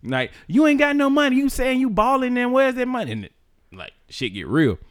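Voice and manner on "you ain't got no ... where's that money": higher, louder taunting cousin voice; mock cousin taunting. Cousin taunting the broke boyfriend, high mock voice. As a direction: taunt him about the money.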